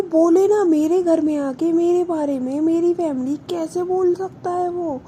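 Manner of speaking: high, loud, indignant retort; angry outburst. Retold or imitated. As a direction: blast it out, hurt and furious. Vani furious about insult at home.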